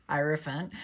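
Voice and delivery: laughing softly while saying it. Real, not acted. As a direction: giggle as you say the word.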